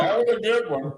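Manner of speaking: talking while eating; words round a mouthful; mouth full. Real, not acted. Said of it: "You're still talking with your mouth full".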